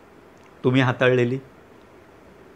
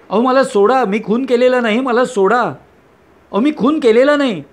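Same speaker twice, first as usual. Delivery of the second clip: frantic shout; 5 semitones higher than usual. Acted. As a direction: yell it like a man losing his grip, dragging out the last line. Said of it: "हेच तुम्ही वेड्यासारखं परत परत ओरडत राहता". (उंच, मोठ्याने घाबरलेली आरोळी)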